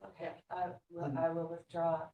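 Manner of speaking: ordinary talk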